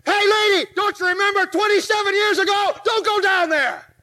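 teasing yell at the movie heroine; yelling at the screen. Retold or imitated. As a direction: warn her off like a fan at a horror film. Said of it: "I'd yell things like".

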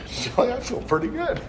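giddy relief. Retold or imitated. Surprised relief after unloading.

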